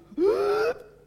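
sharp high gasp for air; loud rising gasp of air; imitated baby gasp. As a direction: gasp like a baby finally breathing. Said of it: "she let out a massive".

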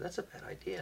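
hushed, breathy aside; whispered warning. Retold or imitated. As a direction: say it under your breath.

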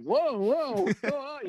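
high, retold startled reaction; mock alarm. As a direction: act taken aback by the boss's outburst.

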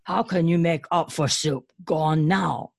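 gruff ogre questioning. Acted. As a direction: speak as a brutish ogre.

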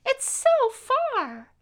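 put-on high, slow, wondering child voice; awed small child. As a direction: stretch it out like an amazed little boy. Small child staring out window.

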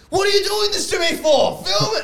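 very high, pleading mock shout; mock exasperated yell. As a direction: imitate a friend desperately nagging. Acting out friend's exasperated demand to film.

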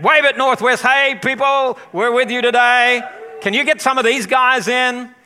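loud, high greeting across a room; calling out. Real, not acted. Pastor greeting Northwest on the livestream, calling out. Hailing the other campus on livestream.

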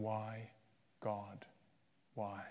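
soft, quiet, falling why; hushed echo of a shout. Retold or imitated. Jesus on the cross, echoed quietly and softly.

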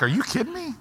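high, mocking disbelief; incredulous pushback. Preacher voicing the congregation's disbelief, high and incredulous. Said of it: "'Cause you're like".

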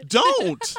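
high, loud playful "don't"; loud protest. Real, not acted. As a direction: cry out in mock protest.

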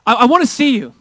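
loud, high-pitched plea; Moses. Acted. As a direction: cry out to God, urgently pleading. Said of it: "It was like Moses going".

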